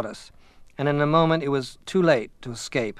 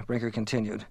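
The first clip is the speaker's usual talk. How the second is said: usual speaking voice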